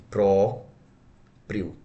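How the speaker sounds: "Próprio" split into pró and prio; separado em sílabas. Shown as a demonstration. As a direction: hold a clear pause between the syllables of "Próprio". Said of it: "Como é que eu separo a palavra". ("Próprio" em duas sílabas bem separadas)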